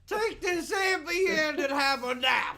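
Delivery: very high put-on comedian voice; hammed-up celebrity impression; Bill Cosby. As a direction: push the pitch way up in a goofy impersonation. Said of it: "I'm still Cosbying".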